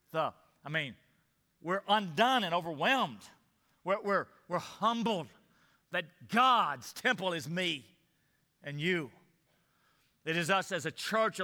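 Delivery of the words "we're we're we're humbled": ordinary delivery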